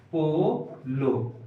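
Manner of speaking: Polo stretched very slowly with a falling end; drawn-out word. Shown as a demonstration. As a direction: spell out polo slowly for the class. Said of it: "पोलो इस तरीका से लिखते हैं ना". (पोलो को धीरे धीरे खींचकर)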